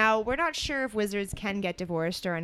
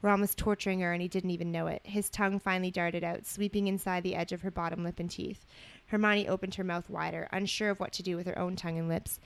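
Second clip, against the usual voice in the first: measured storybook reading voice; story narration. A narrator's reading. Co-host reading romantic fan fiction aloud, story narration. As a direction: read it out evenly as narrative.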